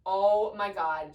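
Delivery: loud, slow mock exasperation; exasperated retort. Mother retells answering the soothing nurse, loud exasperated groan. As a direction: cut in loudly, stretching it out in annoyance.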